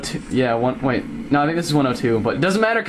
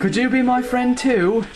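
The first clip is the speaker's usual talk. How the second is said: put-on British accent; 9 semitones higher than usual. Skull Kid voice continues.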